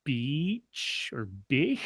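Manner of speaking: Beach or Bich drawn out very slowly, unsure; puzzled coworker guessing a name; slow guessing pronunciation. Retold or imitated. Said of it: "the guy looks at it and he says".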